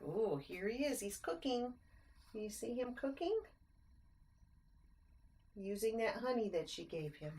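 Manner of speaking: usual speaking voice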